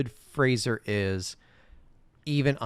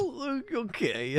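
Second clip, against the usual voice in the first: laughing; 13 semitones higher, 5 dB quieter, slower than usual. Real, not acted. Laughing disbelief.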